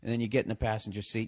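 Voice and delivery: normal talking voice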